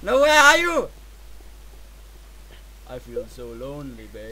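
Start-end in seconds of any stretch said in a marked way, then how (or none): 2.9-4.3 very low, soft murmur; hushed, low self-pity, played up; mock lonely lament. Mock lament while alone in game.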